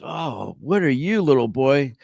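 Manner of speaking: put-on high, cooing grown-up to a little kid; lilting, high-pitched question to a child; man greeting a child. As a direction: play the doting neighbor at the door. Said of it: "he sees the one kid and he goes".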